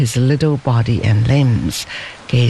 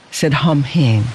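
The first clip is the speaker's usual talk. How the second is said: usual speaking voice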